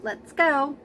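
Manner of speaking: normal talking voice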